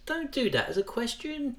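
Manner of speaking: "Don't do that" pitched high like a question. Retold or imitated. Child's brain hearing the parent's order, high questioning voice. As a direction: lift "Don't do that" way up, without shouting.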